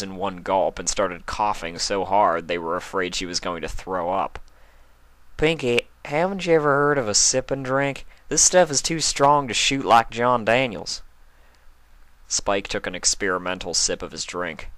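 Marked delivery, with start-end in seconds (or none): 5.4-11.0 higher, louder farm-pony voice; lightly voiced country pony; Applejack voice. A narrator's reading. Applejack scolding Pinkie, higher and louder.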